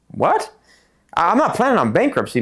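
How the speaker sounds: high-pitched, stammering protest; retold disbelief. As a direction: react stunned, voice jumping up. Retold shocked reply to bankruptcy advice.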